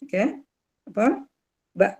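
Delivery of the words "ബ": ordinary talk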